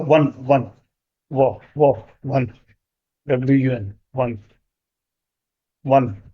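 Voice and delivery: वन said as wan with a w sound; pronounce one. Shown as a demonstration. Teacher showing students how one is pronounced, वन. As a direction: repeat वन slowly, starting with a clear w. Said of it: "अगर आप इसको pronounce करेंगे तो क्या आता है".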